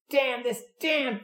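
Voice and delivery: high cartoonish whine of frustration; whiny curse. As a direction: imitate the mechanic cursing a stuck bolt. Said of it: "he had sort of a high, whiny voice".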